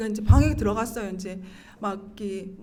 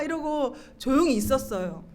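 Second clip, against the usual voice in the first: ordinary delivery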